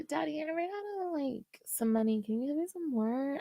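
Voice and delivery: high, soft, whiny begging voice; begging for money. Retold or imitated. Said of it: "I don't have a".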